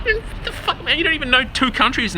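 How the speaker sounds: incredulous squeal, dropping towards the end; high-pitched incredulity. Real, not acted. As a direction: shoot up into a very high, exasperated pitch. Exasperated rant at glib excuses.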